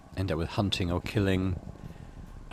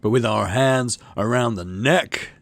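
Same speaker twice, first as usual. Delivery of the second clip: higher, louder and slow, booming; pompous governor; 4 semitones higher, 7 dB louder than usual. Acted. Governor Lum addresses the colony square with a booming pompous speech. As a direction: orate grandly to the assembled colonists. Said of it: "he begins".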